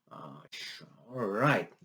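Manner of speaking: very soft, low grumble; acted private reluctance, hushed; reluctant mutter. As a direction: mutter it under your breath. Acting reluctant giving in.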